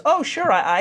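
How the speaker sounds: obliging help desk. Acted. Help desk agrees to the caller.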